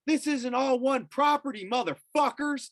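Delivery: very high, indignant protest; retold screaming. Landowner arguing with people in suits, high angry yell. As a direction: re-enact a furious protest, pitch way up. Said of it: "screaming at 'em".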